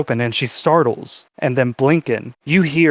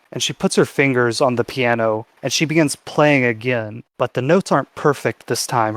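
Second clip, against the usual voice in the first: ordinary delivery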